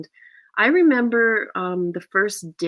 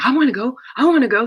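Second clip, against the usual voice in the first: put-on high, louder, childlike eagerness; excited, insistent repeat; eager pleading; 7 semitones higher, 5 dB louder, much faster than usual. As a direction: imitate someone begging to come along. Retells co-star's eager request.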